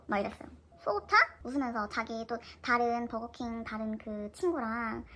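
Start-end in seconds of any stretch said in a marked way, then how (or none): 0.8-1.2 high, louder amused question; surprised echo. Retold or imitated. Crush surprised at pricey request. (놀라서 되묻는 말투)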